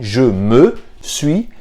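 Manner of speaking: slow, spaced-out "je me suis"; slow demo. Shown as a demonstration. Teacher slowly shows the pronome before the auxiliar. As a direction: lay out "je me suis" word by word. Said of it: "antes você coloca o pronome".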